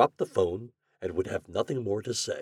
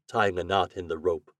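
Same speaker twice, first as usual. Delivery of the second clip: ordinary delivery, nothing marked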